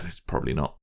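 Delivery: ordinary delivery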